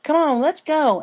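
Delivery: put-on a child pony rallying friends; eager filly. A narrator's reading. Apple Bloom rallies the crusaders, excited high voice. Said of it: "Apple Bloom said".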